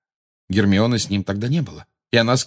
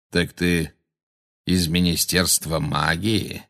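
slow, lower-pitched centaur voice; stern centaur; 4 semitones lower, slower than usual. A narrator's reading. Magorian questions Umbridge about the Ministry, stern centaur. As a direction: question slowly and weightily.

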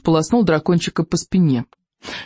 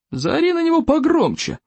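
gruff father chief; 11 semitones higher than usual. Acted. Father advises son.

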